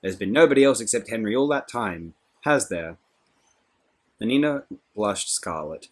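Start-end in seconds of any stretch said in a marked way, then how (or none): 0.0-3.0 accusing. A narrator's reading. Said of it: "pointing an accusing finger".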